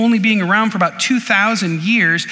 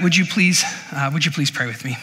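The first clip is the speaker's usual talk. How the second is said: ordinary talk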